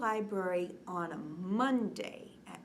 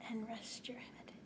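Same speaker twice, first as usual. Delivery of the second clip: very soft whisper; 14 dB quieter than usual. Acted. Librarian whispering children to rest their heads. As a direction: breathe it out gently. Lulling kids to sleep.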